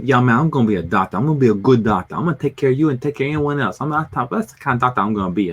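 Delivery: quick, insistent imitation of a Cajun friend; rambling Cajun accent, quickly run together; tipsy Cajun buddy. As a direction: do a beery Cajun buddy making promises. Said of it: "after several beers".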